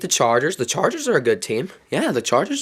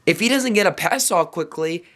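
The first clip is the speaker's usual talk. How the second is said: normal talking voice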